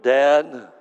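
shouted high and loud with a big smile; a grinning shout of love to a departing friend; joyful yell. Retold or imitated. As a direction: call it out the truck window, beaming. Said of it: "yells at me with this massive smile".

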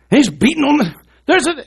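indignant donkey in a high, loud squawk; talking donkey. Acted. Balaam's donkey turns round to protest, high loud indignant voice. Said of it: "the donkey turns around and says".

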